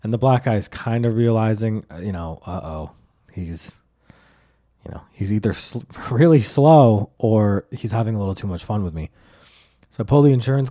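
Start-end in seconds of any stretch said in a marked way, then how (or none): none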